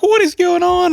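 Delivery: high, startled what is going on; sudden alarm at police boxing him in; alarmed disbelief. Retold or imitated. Telling a friend about being boxed in, high startled disbelief.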